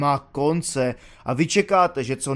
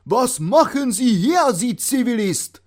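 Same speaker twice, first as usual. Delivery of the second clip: loud, high, slow German bark; yelling colonel; 8 semitones higher, 5 dB louder than usual. Retold or imitated. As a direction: bellow down from horseback at the civilian. Colonel yells at recruit. (obrst řve na dobrovolníka z koně)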